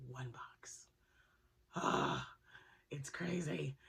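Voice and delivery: awed whisper. Real, not acted. Vlogger whispering to viewers about her last yarn box. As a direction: hush it like you can't believe it.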